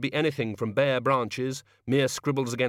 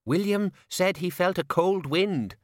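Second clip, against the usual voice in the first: high, slow, uneasy waiter; a touch of fear; nervous; 8 semitones higher than usual. A narrator's reading. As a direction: report it like a frightened waiter.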